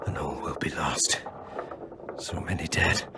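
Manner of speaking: low, fading whisper; despairing whisper. Acted. Wounded elf foreseeing defeat, low whisper. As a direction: let it die away in despair.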